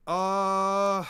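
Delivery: long, high, held "uhhh"; exasperated sustained groan; loud drawn-out groan. Real, not acted. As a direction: groan loudly and hold it. Exasperated by the task.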